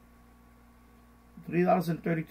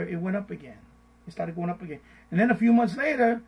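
ordinary delivery

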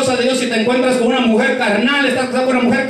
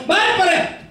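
high-pitched mock wife giving orders; bossy wife; 8 semitones higher than usual. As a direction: order him about in a raised pitch.

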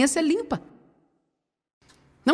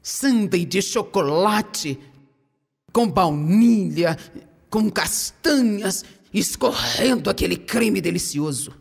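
tempting, savoring; 5 semitones lower, much slower than usual. Acted. Tempting the audience with dessert.

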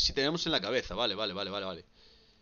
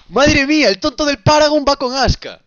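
thrilled shout; 9 semitones higher, 12 dB louder than usual. Real, not acted. Thrilled by the team-up.

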